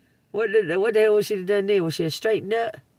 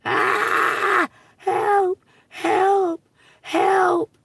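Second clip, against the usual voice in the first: much higher and louder fake screams; mock cries for help; 12 semitones higher, 5 dB louder, much slower than usual. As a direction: ham up a panicked woman yelling for help. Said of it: "just gonna sit here and act crazy".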